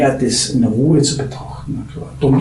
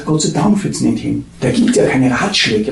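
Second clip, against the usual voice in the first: usual speaking voice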